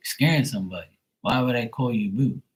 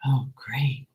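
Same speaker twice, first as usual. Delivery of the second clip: breathy higher whisper, slow; mocking someone thrilled at a call; mock swooning whisper; 6 semitones higher, slower than usual. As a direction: whisper like a lovestruck fan. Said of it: "be like".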